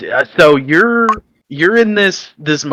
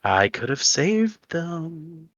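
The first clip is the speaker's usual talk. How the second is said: slow, much softer, higher voice in her head; tempting inner voice; 5 semitones higher, 10 dB quieter, much slower than usual. Acted. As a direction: whisper regret into her mind slowly. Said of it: "you hear a voice in the back of your head".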